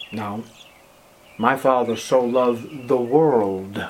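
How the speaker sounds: Jesus gently correcting Nicodemus; hushed Jesus answer. Acted. Preacher voices Jesus answering.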